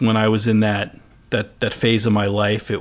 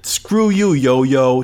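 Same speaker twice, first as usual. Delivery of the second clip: put-on Philly accent; much slower than usual. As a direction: imitate a Philly kid's accent, pitched up on SCREW. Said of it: "I can't do that accent".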